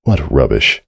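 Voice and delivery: ordinary delivery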